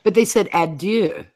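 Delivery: "adieu" said the French way, ah-DYUH. Shown as a demonstration. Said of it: "like the French word for goodbye".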